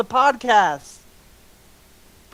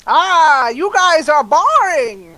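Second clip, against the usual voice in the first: high, drawn-out whine with loud swoops; worm alter ego; 5 semitones higher, 5 dB louder, slower than usual. Acted. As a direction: wail it slowly as a petulant worm character. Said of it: "Now [name] has three personalities".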